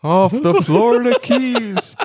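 rising ghostly wail; low haunting voice. Pretend. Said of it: "do this in a ghost voice".

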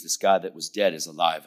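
ordinary talk

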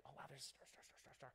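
breathless, whispered wonder at each star; awed whisper. Retold or imitated. Describing looking up at stars, in a soft awed whisper. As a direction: hush it, counting stars in awe.

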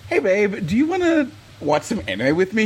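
high, sweet-talking ask to a partner; coaxing request. Retold or imitated.

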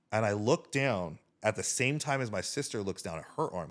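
usual speaking voice

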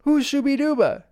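high, swooping puzzled questions; incredulous dad. Retold or imitated. Bandit answers the girls' teasing, incredulous. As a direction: act out a dad baffled by a silly name. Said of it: "And Bandit says".

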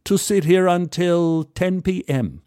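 a polite English gent's greeting; English accent. Retold or imitated. English stranger greets narrator at the bar, lightly English. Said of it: "He, he was English".

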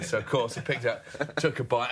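normal talking voice

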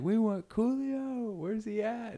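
high, drawn-out mock crowd call; imitated demanding crowd. Impatient room demanding the missing act, high mock crowd yell.

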